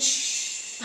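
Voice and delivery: soft acted shush; hushing. Friend shushing mid-mugging, hushing. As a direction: hush them gently.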